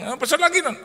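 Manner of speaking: high, loud excited call, imitated; eager reorder. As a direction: call out like a greedy happy friend. Teman memanggil untuk pesan lagi.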